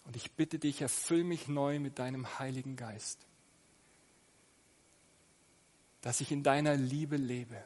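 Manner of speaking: spoken prayer. Acted. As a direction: pray humbly and gently aloud. Leads the congregation in prayer.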